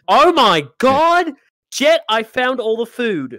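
loud, slow astonished call; excited shout. Real, not acted. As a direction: call out the big find. Discovering food in the back.

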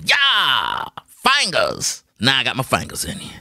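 a hint of the chief's gleeful threat; Chief's catchphrase. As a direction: imitate the chief, teasing. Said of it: "the way he would say it".

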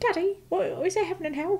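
put-on high little Cockney kid voice; very high Cockney child asking Daddy. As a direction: put on a small Cockney urchin. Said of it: "a Cockney accent".

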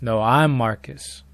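slow, understated eerie impostor; lightly voiced caller, slow and measured; mysterious caller voice. Mysterious caller claiming to be Marcus, slow and subdued. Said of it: "The voice goes".

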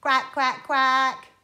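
loud duck quacks in even beats. Shown as a demonstration. Leading children in the noise, loud quacking. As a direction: quack loudly for others to copy. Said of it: "Can you make that noise".

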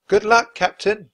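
loud, slow farewell call. A narrator's reading. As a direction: call after him into the crowd. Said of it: "Jack called".

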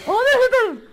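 screamed high and very loud; terrified scream. Real, not acted. Gamer screaming at a sudden scare. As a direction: scream it in sheer panic.

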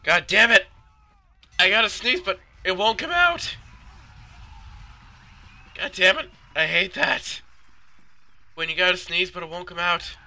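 high, loud exasperated complaint; high-pitched, loud griping; frustrated whine. Real, not acted. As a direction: yell in exasperation at a sneeze that won't come. Complaint about a stuck sneeze.

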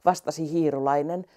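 usual speaking voice

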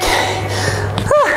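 long airy blow-out of breath; breathless whew. Real, not acted. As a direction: puff out hard after the set. Tired instructor exhales on a break.

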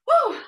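winded laugh on an exhale; breathless laughing whew. Real, not acted. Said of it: "I'm out of breath".

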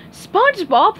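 high, loud call of the name SpongeBob; yelled. Acted. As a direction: shout SpongeBob across the crowd. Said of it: "yelled Sandy".

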